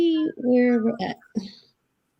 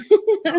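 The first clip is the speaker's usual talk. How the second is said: happy high laugh; 10 semitones higher than usual. Real, not acted. Praising streamer.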